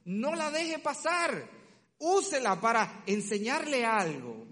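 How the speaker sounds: high, slow, stressed plea not to waste it; slow insistent urging. Real, not acted. Preacher urging parents slowly and emphatically. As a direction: press every word slowly and firmly.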